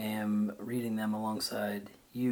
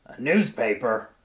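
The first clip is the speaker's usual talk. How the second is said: scornful; 2 semitones higher, 8 dB louder than usual. A narrator's reading. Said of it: "snatched it roughly with a grabbing disparagement".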